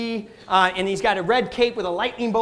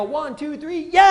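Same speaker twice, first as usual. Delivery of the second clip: cheering; 5 semitones higher than usual. Retold or imitated. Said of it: "My wife and I go".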